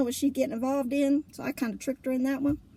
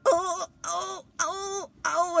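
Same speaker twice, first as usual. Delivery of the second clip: imitating frightened wails, pitched up; terrified moaning; 6 semitones higher, much slower than usual. Marie panicking on the dark coaster, frightened wailing. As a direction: wail like you're stuck on the coaster. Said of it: "she went".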